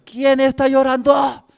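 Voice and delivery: loud, high-pitched outburst; exasperated shout. Retold or imitated. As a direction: yell as if at the end of your patience. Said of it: "yo también no podía aguantar". (grito de quien ya no aguanta)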